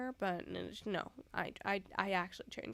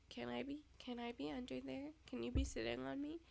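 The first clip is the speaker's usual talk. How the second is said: soft pleading; 3 semitones higher, 9 dB quieter than usual. Retold or imitated. Pleading with the cat.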